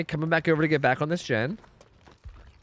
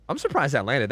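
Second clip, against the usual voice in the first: ordinary delivery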